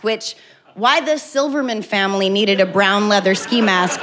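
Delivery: normal talking voice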